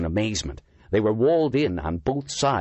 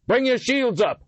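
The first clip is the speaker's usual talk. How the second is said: shouted order; 4 dB louder than usual. A narrator's reading. Barked order under attack.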